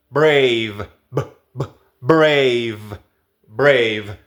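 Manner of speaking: "brave" with a hard voiced lip b; strong bilabial b in "brave"; exaggerated b. Shown as a demonstration. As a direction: press lips and pop "brave". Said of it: "tienes que exagerarlo".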